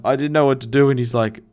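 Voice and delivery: usual speaking voice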